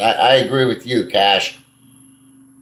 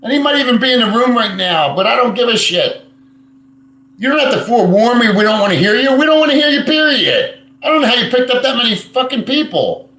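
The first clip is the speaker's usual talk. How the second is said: angry rant; 11 semitones higher, 4 dB louder than usual. Real, not acted. Ranting at rival vlogger.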